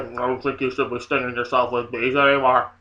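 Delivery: low, mock husband voice; husband confronting her. Husband confronting his wife about stinging, low voice. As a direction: put on a deep man's voice. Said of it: "her husband confronted her and he was like".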